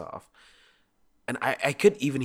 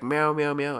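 high, louder string of meows; insistent cat meows; 6 semitones higher, 6 dB louder than usual. Acted. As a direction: imitate a cat pestering for attention. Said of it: "He would meow at me".

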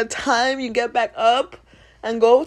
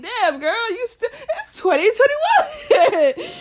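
high laughing line; giggling amused; 10 semitones higher, 3 dB louder, slower than usual. Real, not acted. Laughing through retelling.

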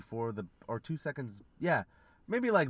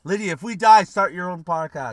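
high, loud mock last plea; calling out; 5 semitones higher, 12 dB louder than usual. Host to fan before nuclear doom, mock-urgent shout. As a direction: shout a final message to a faraway fan.

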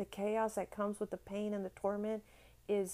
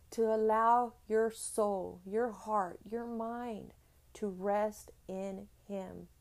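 normal talking voice

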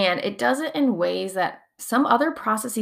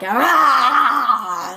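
a sudden louder cry to shake off tension; short demo shout, higher and louder; release scream; 5 semitones higher, 8 dB louder than usual. Shown as a demonstration. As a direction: let out a quick yell of release. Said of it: "and, like, shake and scream".

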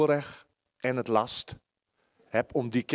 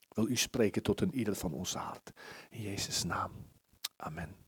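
soft, low closing prayer; hushed church prayer; 6 semitones lower, 8 dB quieter than usual. Acted. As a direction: pray quietly to God.

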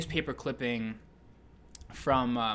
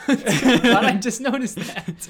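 higher, louder, laughing through the words; 9 semitones higher, 10 dB louder than usual. Real, not acted. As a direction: crack up at the silly painting. Laughing at the painting.

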